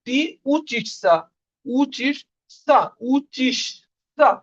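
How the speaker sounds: very slow, drawn-out উচিসা for students; slow drill. Shown as a demonstration. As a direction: stretch each syllable like a drill. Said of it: "teacher drilling conjugation". (ধীরে ধীরে টেনে উচ্চারণ)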